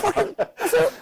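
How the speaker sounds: very high squeal of disbelief; exasperated disbelief. Retold or imitated. Manager retelling his reaction to the red card, squeaky exasperation. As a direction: voice shooting right up, half laughing. Retold disbelief at absurd sending-off.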